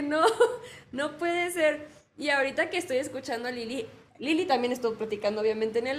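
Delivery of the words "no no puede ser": slow, laughing no puede ser; can't stop laughing at it; laughing disbelief. Real, not acted. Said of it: "yo me reí muchísimo". (incredulidad entre risas)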